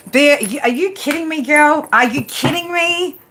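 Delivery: high, incredulous squeal at a viewer; shocked disbelief. Real, not acted. Streamer reacting to a viewer named Bear, incredulous.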